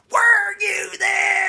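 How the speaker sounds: drawn-out, high, full-force scream; screamed refrain. Retold or imitated. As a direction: imitate a performer screaming her refrain, stretching every word.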